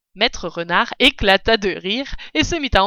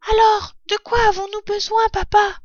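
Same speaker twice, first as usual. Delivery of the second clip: put-on very high, raised child voice; little fox cub; 13 semitones higher, 6 dB louder than usual. A narrator's reading. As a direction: ask papa like an eager little one. Fox cub questions his father. (petite voix aiguë de renardeau)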